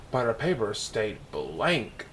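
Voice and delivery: ordinary delivery, nothing marked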